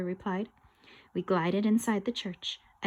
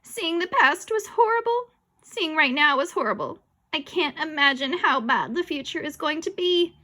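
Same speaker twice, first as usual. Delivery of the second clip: higher-pitched, agitated complaint; distressed protest; 6 semitones higher, 3 dB louder than usual. Acted. Boy dreads being shown the future, distressed protest. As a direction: wail in dismay.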